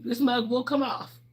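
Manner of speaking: ordinary talk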